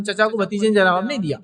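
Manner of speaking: usual speaking voice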